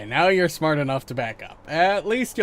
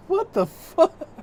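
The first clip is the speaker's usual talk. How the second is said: shocked; slower than usual. Real, not acted. Shocked reaction.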